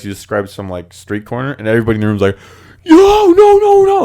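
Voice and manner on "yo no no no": very high, loud panicked wail; drawn-out falsetto cry of imitated alarm; mock freaking out. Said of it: "They're all freaking out".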